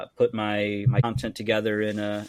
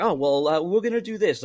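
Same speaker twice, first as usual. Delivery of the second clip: high, louder mock salesman promise; mock agency pitch; 8 semitones higher, 4 dB louder than usual. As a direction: ham up the agency's breezy promise. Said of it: "they're like".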